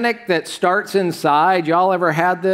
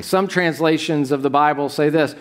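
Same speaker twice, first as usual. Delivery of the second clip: ordinary talk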